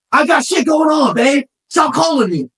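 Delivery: high loud brush-off to his fiancee; ignoring his fiancee's calls, a mock annoyed shout. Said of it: "most of the time I'd be like".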